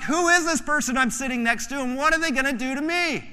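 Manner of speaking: alarmed. Retold or imitated. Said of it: "You'd be like".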